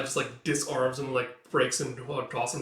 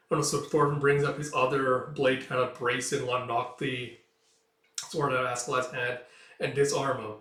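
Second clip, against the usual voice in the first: plain reading voice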